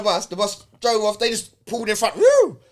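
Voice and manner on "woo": high loud whoop like a siren; a sudden, much higher, louder "woo!" as the cars cut in. Retold or imitated. Telling friends how police boxed the bus in, whooping loud and high.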